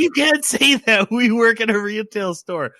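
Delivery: high-pitched scolding, hammed up; mock scandalized protest. Coworkers telling off Fox Mask at the store, mock scandalized. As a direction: imitate aghast coworkers, pitched well up.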